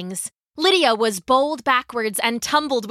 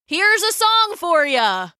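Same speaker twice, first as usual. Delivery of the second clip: bold shouted taunt; 7 semitones higher, 4 dB louder than usual. A narrator's reading. Galen challenging lions with his tambourine, calling out. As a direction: announce it with defiant gusto.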